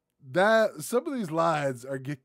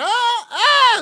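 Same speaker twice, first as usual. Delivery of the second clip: startled yelp; 17 dB louder than usual. Real, not acted.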